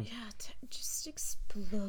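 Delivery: conspiratorial whispered aside. Acted. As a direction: slip in the advice as a whisper. Sly aside to the listener.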